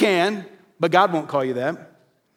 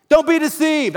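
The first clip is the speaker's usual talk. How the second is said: higher, louder, pressing warning; raised, urgent warning to the listeners; 8 semitones higher, 4 dB louder than usual. Real, not acted. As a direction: push it out with urgency. Preacher warns congregation.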